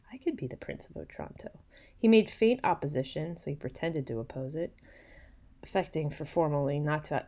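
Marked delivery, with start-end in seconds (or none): 0.1-1.5 much softer, scheming whisper; hushed, sly aside. Pretend. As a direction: murmur the ambition as a secret.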